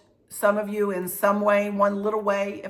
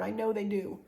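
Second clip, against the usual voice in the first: normal talking voice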